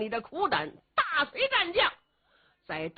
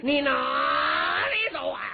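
slow, drawn-out shouting challenge; calling after him; 3 dB louder, slower than usual. Acted. A warrior shouts after his fleeing foe, drawn out. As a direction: hold the call long and loud, like a battle cry.

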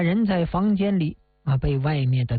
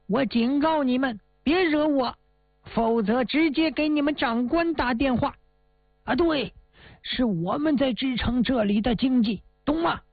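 high-pitched, self-important telling-off; indignant warning; 7 semitones higher than usual. A narrator's reading. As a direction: warn them off in a high, insistent voice. Acted guest warning the guards. (高声警告、摆架子训人)

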